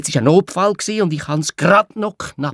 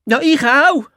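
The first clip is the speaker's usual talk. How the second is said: hoch und laut gebrüllt; 13 semitones higher, 7 dB louder, slower than usual. Acted. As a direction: genervt zurückbrüllen. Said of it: "hat Clara brüllt". (lautes Brüllen, hohe Stimme)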